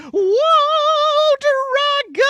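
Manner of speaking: very high loud cry; frightened shout. Acted. Princess fleeing the dragon, shouting. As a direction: yell while running.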